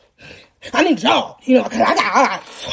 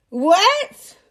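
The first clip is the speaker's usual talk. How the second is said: shocked what; 4 semitones higher than usual. Retold or imitated. Shocked reaction.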